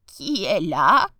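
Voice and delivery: wolf slowly faking grandmother's voice; shapeshifter. Acted. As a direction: draw it out like an old lady. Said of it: "en imitant la voix de la grand-mère".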